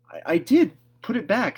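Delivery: high, loud, stammered protest; quickly. A narrator's reading. Anne protesting to Marilla, high and loud. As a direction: blurt it out fast. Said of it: "said Anne quickly".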